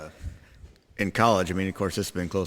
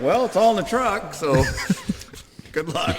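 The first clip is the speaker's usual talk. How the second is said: laughing; 10 semitones higher than usual. Retold or imitated. Retold careless dock worker quote.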